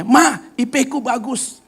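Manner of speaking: high, slow, excited daughter on the phone; a lightly voiced young girl bragging; daughter's happy report. Daughter tells mother her good grade, excited. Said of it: "dia bilang".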